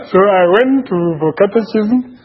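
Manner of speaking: giddy laughing joy. Real, not acted. Joyful memory of baptism party.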